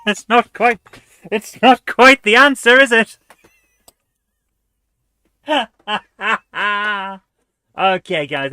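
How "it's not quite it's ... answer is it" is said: loud, high, through laughter; amused, laughing. Real, not acted. Player mocks own wrong guess, loud and laughing. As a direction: struggle to get it out while laughing.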